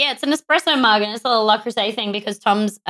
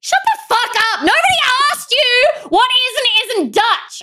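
mock rage; 9 semitones higher than usual. Mock threatening Riley over pedantry.

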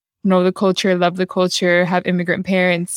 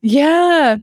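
excited yeah; 9 semitones higher, 5 dB louder than usual. Real, not acted. Emphatic agreement, high burst.

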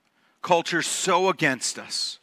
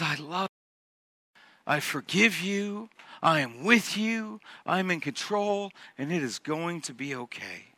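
soft, gentle, unhurried comfort; tender reassurance; 2 semitones lower, 8 dB quieter than usual. Acted. God comforting His people on their knees, tender reassurance. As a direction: hush the voice and reassure lovingly. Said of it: "a moment where God says".

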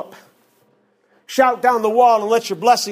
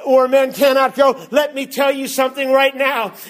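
full-force preaching; 4 semitones higher than usual. Acted. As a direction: preach it hard, line building on line.

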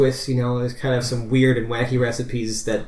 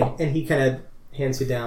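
ordinary talk

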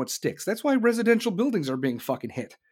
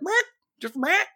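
mocking Biden; 7 semitones higher than usual. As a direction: mumble like a confused old president.

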